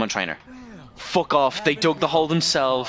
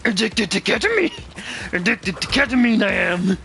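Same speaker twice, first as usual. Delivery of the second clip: higher, slow put-on voice with inverted word order; silly sage voice; 7 semitones higher than usual. Streamer joking about the character, higher, slow wise-master voice. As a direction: ham it up like a tiny wise master. Joke quote with inverted syntax.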